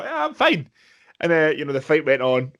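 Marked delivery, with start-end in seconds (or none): 0.0-0.7 dazed reply. Retold or imitated. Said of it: "And you go".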